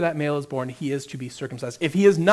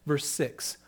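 ordinary delivery

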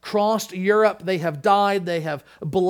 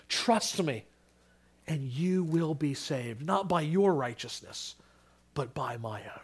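slow, soft, gentle offer; tender invitation; 4 dB quieter, slower than usual. Pretend. Christ to sinners, soft tender invitation. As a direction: hand the gift over tenderly. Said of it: "Instead, he says".

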